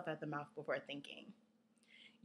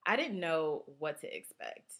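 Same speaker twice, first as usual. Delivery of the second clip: plain reading voice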